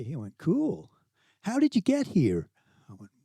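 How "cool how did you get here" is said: high-pitched San Diego teen impression; San Diego accent. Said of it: "do a San Diego accent".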